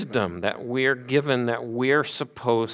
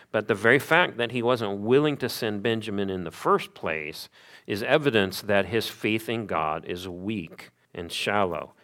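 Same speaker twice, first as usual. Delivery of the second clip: ordinary delivery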